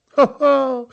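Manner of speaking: high, loud ho-ho laugh; boatman's laugh. Acted. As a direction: burst out laughing at the sight. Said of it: "śmiał się tymczasem wioślarz". (głośny, wysoki śmiech)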